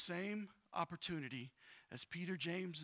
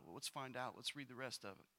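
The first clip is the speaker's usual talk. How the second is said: usual speaking voice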